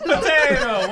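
loud, high hammed-up Italian cry; mock Italian. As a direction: shout it like a cartoon Italian. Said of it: "They're Italian now?".

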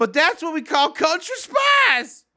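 high, slow country drawl. Pretend. Punchline of country hick bit.

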